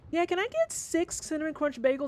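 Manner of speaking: high mock customer voice. Cheerful lady at the register ordering bagels, high mock voice. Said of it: "she's just like".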